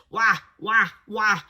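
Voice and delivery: loud, mocking wah wah wah; mock wah noises. Speaker to audience, waving off the brain's needs with loud mock wahs. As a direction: babble it dismissively like a whiny child.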